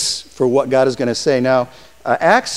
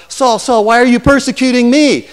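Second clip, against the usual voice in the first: raised, calling voice of the Lord, higher and louder; 8 semitones higher, 5 dB louder than usual. Acted. The Lord calling Saul on the road, raised and booming. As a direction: call his name twice from above. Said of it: "the voice says".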